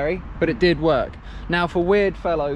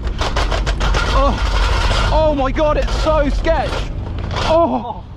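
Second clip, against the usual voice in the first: scared shouting; 7 semitones higher, 4 dB louder than usual. Real, not acted. Scared on a steep ride.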